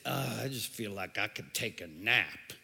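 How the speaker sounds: low, soft, sleepy sigh of a worn-out person; tired mumble. Retold or imitated. A tired person after junk food, sleepy low mumble. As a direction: sound like you could nap right now. Sluggish tired churchgoer voiced.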